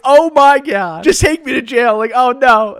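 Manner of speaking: loud, high-pitched dismay; mock panicked outcry. Teen told by officer to call his mom, loud mock panic. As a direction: cry out in exaggerated dread, retelling it. Acted inner dread at calling mom.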